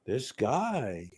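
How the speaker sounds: murmured low and soft, pondering; mock musing mutter. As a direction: muse on it quietly. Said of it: "and go".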